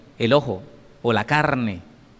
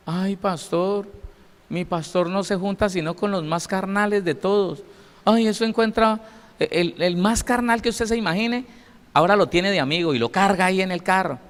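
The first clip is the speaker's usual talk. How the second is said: gossiping churchgoer; 6 semitones higher than usual. Acted. Churchgoer complaining about her pastor, higher and gossipy.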